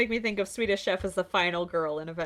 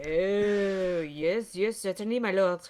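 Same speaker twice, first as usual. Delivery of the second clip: put-on obliging French-accented reply; French character voice. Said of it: "this stupid French voice".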